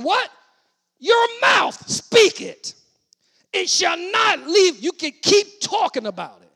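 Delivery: loud, stretched exhortation; full-force preaching. Acted. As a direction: command the congregation.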